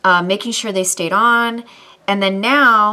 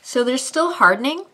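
slight lisp around teeth; speaking with fake fangs; 3 semitones higher than usual. Real, not acted. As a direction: fingers pressing at mouth. Seen 1: Fangs visible on upper teeth; fingers press them into place.

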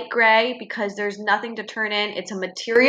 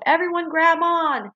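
higher called-out rallying order; yelled call; 7 semitones higher than usual. A narrator's reading. Super Megan urging the others, a raised rallying yell. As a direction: call everyone to grab on. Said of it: "turned around to the others and yelled".

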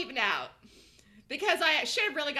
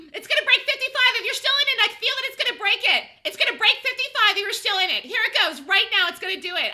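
higher, louder, building excitement; excited; 8 semitones higher, 10 dB louder than usual. Real, not acted. Trader calling the breakout to his viewers. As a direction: announce it as it happens.

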